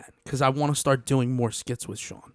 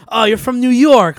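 mock foreigner; 9 semitones higher, 10 dB louder than usual. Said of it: "they're like".

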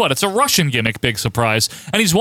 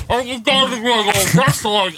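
higher, garbled mock commentary; mock toilet-flushed announcers; 6 semitones higher than usual. Host mocking the boring Kiwi announcers, garbled impression. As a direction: gargle it like an announcer with his head underwater. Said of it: "flushing their heads down the toilet while they talk".

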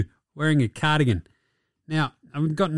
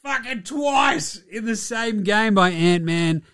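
higher, slow, shouted disbelief; emphatic hype; 6 semitones higher, slower than usual. Real, not acted. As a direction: lean hard on twice. Emphasising getting dunked twice.

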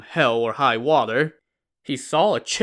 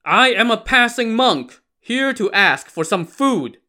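loud, high-pitched shout; a monk shouting for attention; 9 semitones higher, 5 dB louder than usual. A narrator's reading. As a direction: call out forcefully to be heard. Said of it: "Lu Zhishen shouted".

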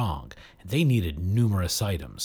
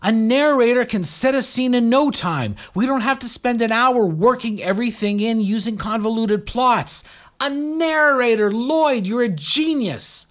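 excited; 14 semitones higher, 7 dB louder than usual. A narrator's reading. Asha excited about the idea.

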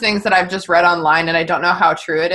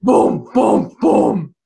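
mock booming knocks; much slower than usual. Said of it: "the bassiest sound you've ever heard".

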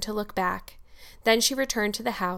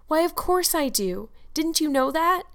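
put-on higher-pitched girl's voice, read aloud; earnest Diana; 7 semitones higher than usual. A narrator's reading. Diana reassures Anne, earnest and high-pitched. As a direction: answer high, as if it were obvious.

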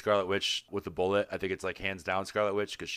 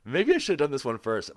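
voice cracking; 6 semitones higher, 3 dB louder than usual. Real, not acted. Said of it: "My voice just cracked".

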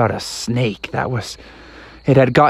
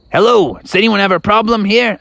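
high-pitched, eager rescuer voice; pig firefighters; 8 semitones higher than usual. Acted. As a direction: announce yourself high, offering help. Pig firemen arrive.

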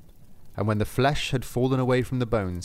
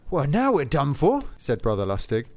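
higher, louder reproach; blaming; 6 semitones higher, 3 dB louder than usual. Acted. Lustig to Saint Peter, mildly raised blame. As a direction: scold him for dawdling.

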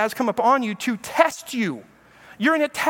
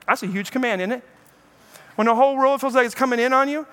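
ordinary delivery

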